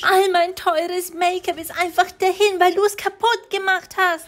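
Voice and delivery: höhere, genervte Mama-Stimme; Mama schimpft. Acted. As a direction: als aufgebrachte Mutter höher sprechen. (Mutter findet Tochter im Schlafzimmer, höher und genervt)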